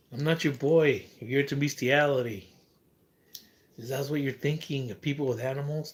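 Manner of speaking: loud and scornful, calling them out; loud angry rant. Real, not acted. Berating viewers who mocked him, loud and angry. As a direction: chew them out, loud and disgusted. Ranting loudly at online commenters.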